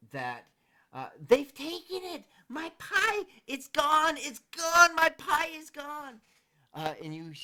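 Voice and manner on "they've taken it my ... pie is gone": high, loud panicked screams; screaming halfling. Acted. Miss Lily screams across the fair, high and loud. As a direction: shriek that the pie is gone. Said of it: "this female voice yell out".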